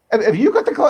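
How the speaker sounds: laughing through a mock question; chuckling tease. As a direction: laugh as you ask it. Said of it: "We'll stop talking like this".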